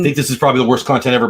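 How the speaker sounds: normal talking voice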